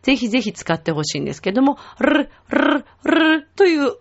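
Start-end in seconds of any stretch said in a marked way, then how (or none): 2.0-3.5 higher-pitched trilled "ルルルル" in separate bursts; rolled R. Shown as a demonstration. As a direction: roll the tongue tip through "ルルルル". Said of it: "この巻き舌は、".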